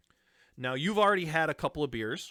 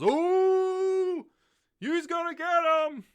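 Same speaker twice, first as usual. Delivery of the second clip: much higher, loud crowd squeal of glee; excited crowd; 8 dB louder, slower than usual. Acted. Tavern crowd eggs on the fight, high excited squeal. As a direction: squeal it like a thrilled onlooker. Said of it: "a crowd that overhears it, goes".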